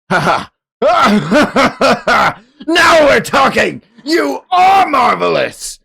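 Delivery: high, loud manic laughing roar; gleeful villain. Acted. Streamer voicing the villain thrilled by his rival, manic shout. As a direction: cackle with battle-crazed delight. Villain delighted by a worthy opponent.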